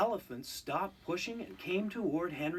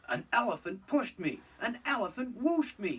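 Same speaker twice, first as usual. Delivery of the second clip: higher, louder outraged engine; indignant grumble; 7 semitones higher, 3 dB louder than usual. A narrator's reading. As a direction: complain you've been soaked. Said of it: "he grumbled".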